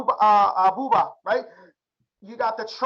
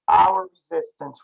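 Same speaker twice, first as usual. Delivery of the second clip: slow, drawn-out drill; 6 semitones lower, slower than usual. Shown as a demonstration. Teacher drilling the class on the term, slow and deliberate. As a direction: stretch out hour, then resistance, slowly. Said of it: "You can literally say it like that".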